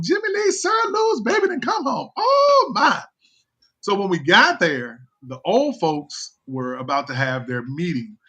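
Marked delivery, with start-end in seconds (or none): 0.0-3.0 high falsetto wail of joy; imitating relatives hugging him. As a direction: squeal it like elated old kinfolk greeting him. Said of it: "and they're hugging him".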